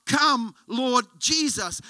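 crying out. Retold or imitated. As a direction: draw out each word as a heartfelt call. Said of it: "literally cry out".

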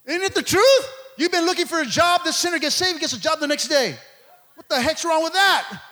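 loud, high, exasperated challenge; comic pulpit outrage; full-force preaching. Real, not acted. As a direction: put the grievance hard to the congregation.

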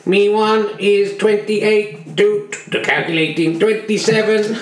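flat robot voice announcing scores. Pretend. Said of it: "let's go to robot voice".

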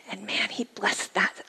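slow whispered awe; moved whisper. Real, not acted. Telling the audience of grace, whispered. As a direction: hush it with feeling. Emotional testimony.

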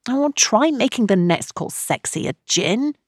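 tired but coaxing; weary. A narrator's reading. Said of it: "Tam's weary voice".

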